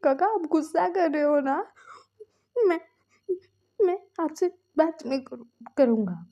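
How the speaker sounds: a younger brother sobbing out his words; crying. Acted. Younger brother crying to his angry elder brother, tearful. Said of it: "वो रो रहा था".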